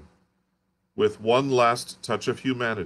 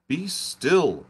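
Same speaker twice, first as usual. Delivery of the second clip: implored; 8 semitones higher than usual. Acted. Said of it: "I implored".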